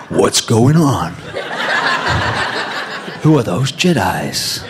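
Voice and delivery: giggling through the audition line; laughing line reading. Retold or imitated. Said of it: "I couldn't get the Fugees out of my head".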